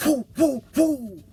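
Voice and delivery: high, light whoops; mild whooping. Retold or imitated. Trucker mimics passing rigs with light whoops.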